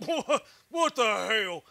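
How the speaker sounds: slow "What, what the hell?" with a laugh through it and a falling end. Shown as a demonstration. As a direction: stretch it out while cracking up. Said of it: "give us an audio command of you saying, 'What the hell,' like that".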